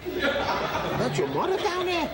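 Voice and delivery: high, slow, disbelieving question; laughing at his friend, amazed. Acted. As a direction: stretch it out in disbelief, half laughing. Mother turns out at racetrack.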